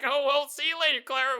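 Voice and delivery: leaving her behind cheerfully; breezy family. Pretend. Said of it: "they're like".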